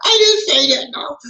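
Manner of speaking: very high-pitched playful denial; mock protest. Denying he said only the Philippines, high mock protest. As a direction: squeal a joking denial. Jokingly disowning a listener's comment.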